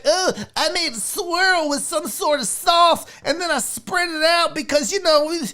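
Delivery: high, put-on pretentious voice; hammed-up pretentious foodie; mocking fancy pizza maker. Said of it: "stop trying to be like".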